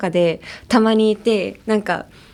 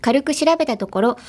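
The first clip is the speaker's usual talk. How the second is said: ordinary talk, nothing marked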